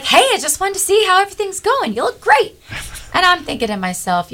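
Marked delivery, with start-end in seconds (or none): 0.0-2.6 much higher, fast, louder jovial greeting; Scott, jovial. Retold or imitated. Friend at the dojo greeting her, high jovial. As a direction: bounce in like a cheerful friend. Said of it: "and he's like".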